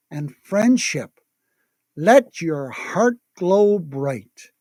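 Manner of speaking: hushed, dreamy meditation guide; airy breathy mystical healer. Acted. Guides townspeople in reverie.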